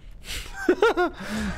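short high-pitched laugh. Real, not acted. Laughs at the player.

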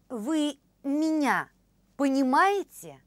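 ordinary talk

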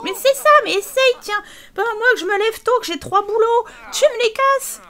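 high, loud taunting fury; wife daring her husband to try it; furious wife. Acted. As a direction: dare him, then rage. Voicing wife in quarrel.

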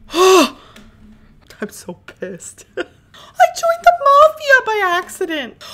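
loud, slow, half-sobbing laughter; laugh-crying frustration. Real, not acted. Player laugh-cries at joining the mafia by accident. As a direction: laughs and wails in despair. Game goes wrong again.

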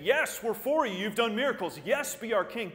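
loud, high eager cheer of the crowd. Retold or imitated. Preacher voicing the crowd hailing Jesus, loud eager cheer. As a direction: hail him like a hopeful crowd.

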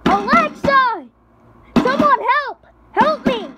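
high frantic screams for help; panicked yelling. Pretend. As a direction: yell desperately to be let out. Trapped, screaming to get out.

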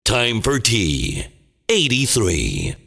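usual speaking voice

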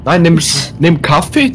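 ordinary delivery

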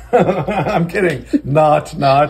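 high, slow giggling; laughing. Real, not acted. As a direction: laugh through the words. Laughing at own joke.

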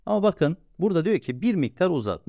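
ordinary delivery

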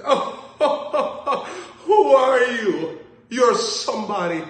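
put-on high, loud, slow gushing old lady voice. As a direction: gush like a starstruck older lady. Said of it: "They said".

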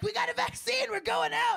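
high-pitched eager crowd imitation; excited mimicry. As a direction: play people thrilled to be let out. Voicing people eager to go out.

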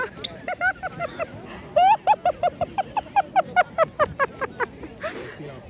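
high loud burst of laughter; big laugh. Real, not acted. Laughing at Nana's long slide.

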